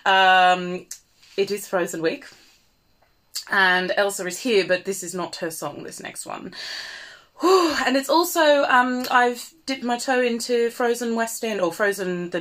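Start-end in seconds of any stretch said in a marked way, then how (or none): 5.8-6.5 breathy unvoiced aside; whispered aside. Real, not acted. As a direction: drop to a whisper for the tease.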